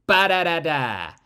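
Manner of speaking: "Ba-da-da-da" loud with the first beat hit hard; rhythm beat. Shown as a demonstration. Teacher beats out the meter of the line. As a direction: tap out the dactyl rhythm. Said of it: "Flatter and praise".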